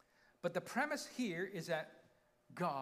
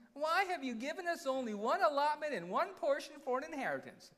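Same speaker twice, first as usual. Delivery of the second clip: high, loud mock whine; hammed-up whiny reading, pitched up; 8 semitones higher, 5 dB louder than usual. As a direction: whine it like a put-upon complainer. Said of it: "I'm gonna read it in a whiny voice".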